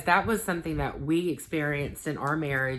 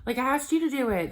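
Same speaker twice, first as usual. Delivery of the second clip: exasperated; 10 semitones higher, faster than usual. Retold or imitated. Thinking to myself, exasperated at husband.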